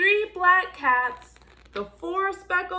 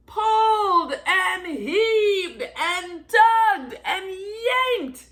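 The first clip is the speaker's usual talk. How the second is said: slow, louder, strained as if pulling hard; straining effort; 3 semitones higher, 5 dB louder, slower than usual. A narrator's reading. Reading the pull to children, straining hard. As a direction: grunt the words out like heaving on a rope.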